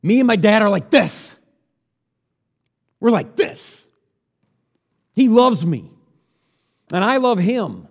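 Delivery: a buddy-buddy Jesus gushing; Jesus paraphrased, excited. Retold or imitated. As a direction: play Jesus bragging about his dad. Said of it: "That's Jesus going".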